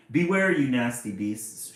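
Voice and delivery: loud bold storybook shout; heroic shout. Acted. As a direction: shout a challenge at the bullies. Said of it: "shouted Bo".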